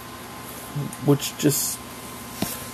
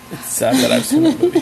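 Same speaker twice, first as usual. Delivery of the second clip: said through laughter; laughing; 12 semitones higher than usual. Real, not acted. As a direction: laugh while admitting it. Laughing about Sand Sharks.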